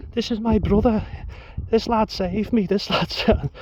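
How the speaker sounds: bad high Scouse accent, cut off mid-word; Scouse woman impression. Missus introducing her rescuer, high mock Scouse. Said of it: "a few bad accents in there".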